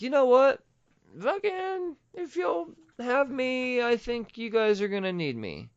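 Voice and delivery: high-pitched acted reply; voiced Blade quote. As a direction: pitch it high, stretching the middle.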